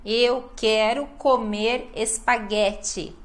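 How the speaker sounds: slow, measured "Eu quero comer espaguete", syllable by syllable; every syllable pronounced. Shown as a demonstration. Teacher demonstrating syllabic rhythm to learners, every syllable pronounced. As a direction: sound out each syllable evenly. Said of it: "a gente fala cada sílaba".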